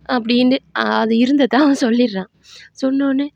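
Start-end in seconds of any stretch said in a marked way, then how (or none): none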